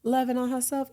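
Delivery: mock listeners. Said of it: "They like".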